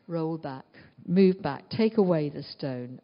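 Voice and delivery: low, deliberate order with punched words; firm command. Acted. Jesus ordering the stone to be rolled back, firm command.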